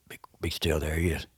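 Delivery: low hushed warning; urgent whisper. Retold or imitated. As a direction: breathe it out so nothing moves. Granddad spots turkey, hushes boy.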